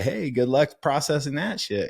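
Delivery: mock flippant. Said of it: "then say".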